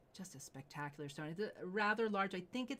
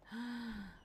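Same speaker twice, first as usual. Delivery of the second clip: gasp; 5 semitones higher than usual. Real, not acted. Host reveals red saddle.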